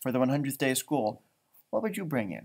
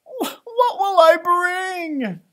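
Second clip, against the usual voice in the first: high, slow, loud near-sobbing wail; panicked cry; 13 semitones higher, 6 dB louder, much slower than usual. Acted. Jessica panicking about her collection, crying out. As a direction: stammer out in despair. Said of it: "she groaned".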